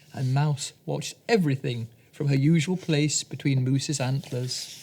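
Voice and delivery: ordinary delivery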